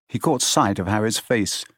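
usual speaking voice

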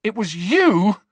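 high, loud shocked gasp. A narrator's reading. Harry learning who attacked him, gasped in shock. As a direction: gasp out the accusation. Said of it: "gasped Harry".

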